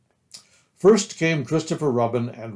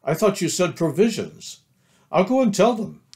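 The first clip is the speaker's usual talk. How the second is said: lifted, higher, cheerful reply; happily and eagerly, pitched up; happy Pooh; 7 semitones higher, 3 dB louder than usual. A narrator's reading. Pooh answers Christopher Robin before leaving, happy. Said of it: "said Pooh happily".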